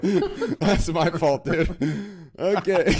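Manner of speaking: normal talking voice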